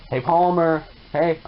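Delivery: ordinary delivery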